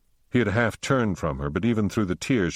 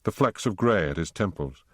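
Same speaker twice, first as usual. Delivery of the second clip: ordinary delivery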